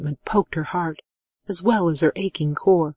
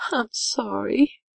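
high, slow, lightly sobbed apology; sobbing; 5 semitones higher, 3 dB quieter, slower than usual. A narrator's reading. Woman apologizing to her lover, lightly acted sobbing. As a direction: draw out a mock-tearful apology. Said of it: "she sobbed".

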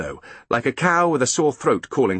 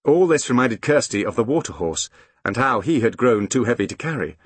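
usual speaking voice

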